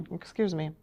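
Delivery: normal talking voice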